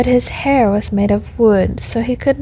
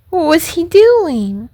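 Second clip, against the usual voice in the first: high-pitched, urgent question; acted worried little puppet; anxious puppet; 7 semitones higher, 4 dB louder than usual. A narrator's reading. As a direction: ask eagerly in the high puppet voice. Puppet anxious for news of papa.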